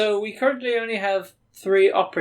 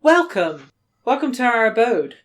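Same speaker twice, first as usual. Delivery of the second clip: higher, lilting welcome; Darlene's greeting; 6 semitones higher, 4 dB louder than usual. Acted. Game master introduces Darlene to the players, higher character voice. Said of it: "That's Darlene".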